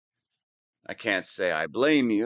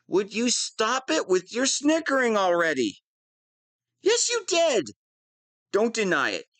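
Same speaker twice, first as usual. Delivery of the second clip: high, shrill-edged exasperation; exasperated outburst; 11 semitones higher than usual. Acted. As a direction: snap at the snickering prisoner. Villain exasperated by snickering.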